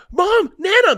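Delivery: put-on high, loud kid call. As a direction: shout for mom across the house. Kids calling into kitchen.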